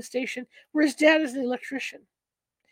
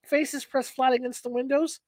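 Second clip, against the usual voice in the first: usual speaking voice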